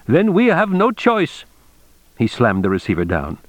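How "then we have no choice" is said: slow, high-pitched indignant shout; shouted ultimatum. A narrator's reading. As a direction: deliver the threat slowly, pitched right up. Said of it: "Mister Tagomi shouted".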